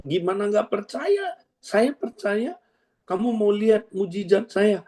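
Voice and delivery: hammed-up triumphant mother; defiant healed woman. Healed woman challenging the elders, high and defiant.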